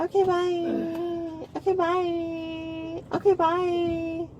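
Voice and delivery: mocking, stretched-out goodbye; mocking dismissal. Real, not acted. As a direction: brush them off in a long sing-song. Mockingly drowns out the officer's question.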